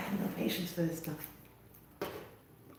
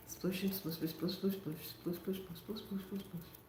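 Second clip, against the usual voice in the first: very soft rhythmic muttering; murmured repetition; 11 dB quieter than usual. Real, not acted. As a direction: chant it under your breath as you work. Chanting to herself while dabbing paint.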